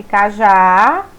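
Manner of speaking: "cajá" with the final "já" drawn out long; stress on last syllable. Shown as a demonstration. Teacher showing students the strong syllable, final stress on "cajá". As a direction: stretch the end of "cajá" to show its strong syllable. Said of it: "será pronunciado com mais força".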